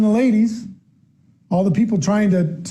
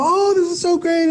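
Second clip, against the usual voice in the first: put-on high, gushing child voice; child thrilled by a new toy, high and gushing; excited child; 10 semitones higher than usual. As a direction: squeal over a new toy. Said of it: "And they go".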